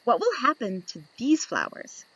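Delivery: ordinary delivery, nothing marked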